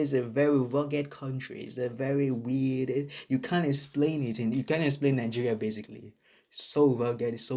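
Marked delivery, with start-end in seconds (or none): none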